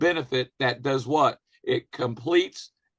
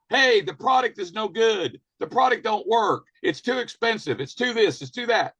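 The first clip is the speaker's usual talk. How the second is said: higher-pitched mock complaining; mock whining; 7 semitones higher than usual. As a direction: whine like a let-down customer, half-hammed. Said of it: "You start whining".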